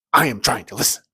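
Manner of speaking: loud, punched words; acted irritated scolding. Woman trying to hear the speaker, irritated scolding. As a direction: imitate an exasperated churchgoer scolding.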